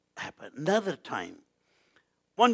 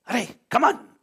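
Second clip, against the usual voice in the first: abrupt louder, higher summons; sudden call; 8 semitones higher, 6 dB louder than usual. Retold or imitated. Swami breaks off his talk to everybody, sudden raised call. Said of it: "suddenly said".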